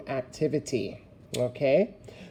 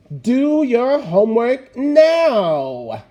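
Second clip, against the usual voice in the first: loud, slow, higher command; loud exclamation; 5 semitones higher, 7 dB louder, much slower than usual. Shown as a demonstration. Teacher showing class an exclamation, said loudly and slowly. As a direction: bark it like a fed-up teacher. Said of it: "I said it loudly".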